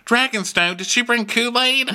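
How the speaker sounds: high-pitched eager squeal; hammed-up excited questioning; excited king. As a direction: ask it high and childlike. King's excited question, acted out.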